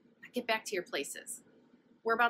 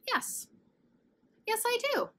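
much higher eager yes; excitedly; 4 dB louder than usual. Acted. Nebraska excitedly agreeing with Kansas, high-pitched and eager. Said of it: "Nebraska said excitedly".

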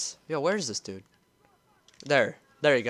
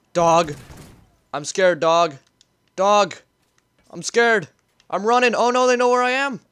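louder, higher scared calls; scared panic; 4 semitones higher, 6 dB louder than usual. Real, not acted. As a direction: panic as you run. Spotted, fleeing in game.

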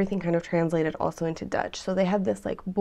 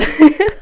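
high burst of laughter; real laughter; loud laugh; 8 semitones higher, 13 dB louder than usual. Real, not acted. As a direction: crack up. Laughs at toe gang.

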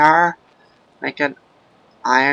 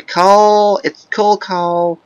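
loud excited shout, drawn out; 6 semitones higher, 6 dB louder, much slower than usual. Real, not acted. Gamer thrilled to find coal, shouting. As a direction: cheer at the find.

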